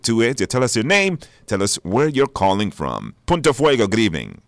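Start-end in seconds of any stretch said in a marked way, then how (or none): none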